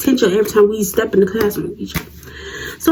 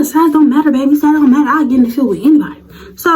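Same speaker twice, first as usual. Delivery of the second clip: ordinary talk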